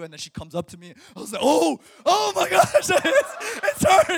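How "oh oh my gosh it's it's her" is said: high, loud fan freak-out; starstruck outburst. Retold or imitated. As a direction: blurt it out like an overwhelmed fan. Acting out meeting his hero.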